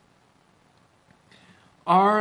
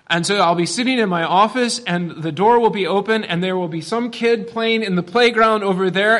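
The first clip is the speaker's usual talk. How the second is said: normal talking voice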